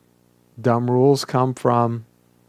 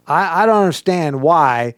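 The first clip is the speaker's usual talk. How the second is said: raised, exasperated protest; indignant, pitched-up objection; exclaimed complaint; 4 semitones higher, 4 dB louder than usual. Shown as a demonstration. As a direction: declare your bafflement as a judgment. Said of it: "exclamation point".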